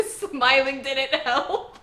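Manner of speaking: loud, drawn out through laughter; laughing. Real, not acted. Reviewer tickled by Anya's big smile backfiring, laughing loudly. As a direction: crack up as you say it, stretching the words. Cracks up retelling the scene.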